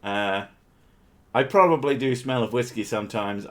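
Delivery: usual speaking voice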